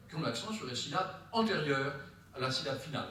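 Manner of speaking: normal talking voice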